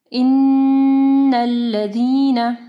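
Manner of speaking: इन्नल्लज़ीना with a long humming double n; slow tajweed chant, holding the nasal n of इन्नल्लज़ीना; full ghunnah. Shown as a demonstration. Teacher models the verse for Quran students, full ghunnah. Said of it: "यहाँ पे कामिल गुन्ना होगा".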